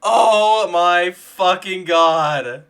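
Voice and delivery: slow, loud, overjoyed; stunned, thrilled exclamation; elated disbelief. Real, not acted. Just won the pull.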